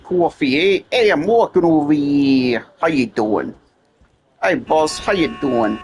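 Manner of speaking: put-on old-time Jersey mobster accent; light New Jersey mafia accent. Shown as a demonstration. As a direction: greet the boss like a Jersey wiseguy. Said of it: "kinda like a New Jersey accent".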